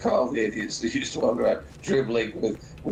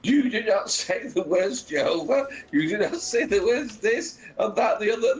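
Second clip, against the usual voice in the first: retold John Cleese; 6 semitones higher than usual. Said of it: "John Cleese turns around and said".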